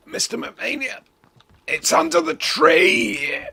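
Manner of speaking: strained, choked-out plea; half-strangled voice. Retold or imitated. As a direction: force the words out after being throttled. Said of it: "get my voice back".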